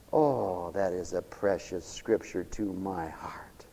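slow, soft whispered aside; tender whisper. Real, not acted. Preacher confiding his favorite scripture, tender whisper. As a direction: breathe it out tenderly.

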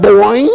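boing. Pretend.

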